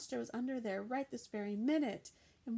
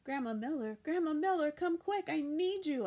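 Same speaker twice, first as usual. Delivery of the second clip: high, loud frightened call; scared girl shouting for grandma to hurry; shouting for help; 6 semitones higher, 6 dB louder than usual. A narrator's reading. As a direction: shout for grandma to come quick. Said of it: "I shouted".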